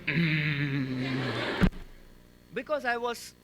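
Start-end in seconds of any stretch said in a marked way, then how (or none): 0.1-1.7 quiet held-in "Mm" with lips shut; soft tight-lipped hum; closed-mouth hum. Retold or imitated. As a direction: keep your mouth clamped and hum. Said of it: "I kept my mouth".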